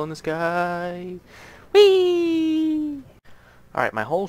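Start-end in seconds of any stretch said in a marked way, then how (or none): 1.7-3.0 high, loud squeal of joy; joyful whee. Real, not acted. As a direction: squeal like on a ride. Riding the minecart.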